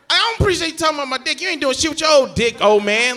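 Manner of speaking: loud, high, fast comic rant; high-pitched acted outrage, rushed; mock-indignant retort. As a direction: snap back at granddad, hammed-up. Acted retort to teasing granddad.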